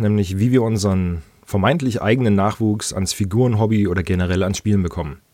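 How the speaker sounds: usual speaking voice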